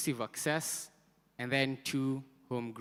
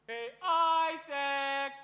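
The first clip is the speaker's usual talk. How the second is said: much higher, slow stretched "Isaac"; louder rally call; 12 semitones higher, much slower than usual. Shown as a demonstration. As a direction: push the chant higher for the crowd.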